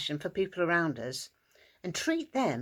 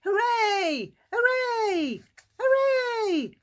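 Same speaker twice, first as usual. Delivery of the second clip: high, loud cheers climbing higher each time; joyful cheering; 5 dB louder, much slower than usual. Acted. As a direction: cheer like you've just been set free. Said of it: "and cheered".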